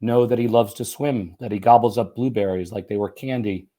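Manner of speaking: ordinary delivery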